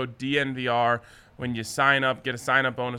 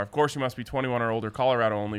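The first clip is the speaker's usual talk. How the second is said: fast legal disclaimer. Acted. Reads sportsbook disclaimer.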